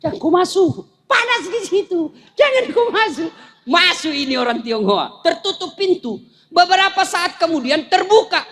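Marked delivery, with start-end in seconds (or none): 0.0-3.3 rising alarmed warning, lightly voiced; urgent warning. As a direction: beg him not to step in, getting higher. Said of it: "bahasa Bugisnya".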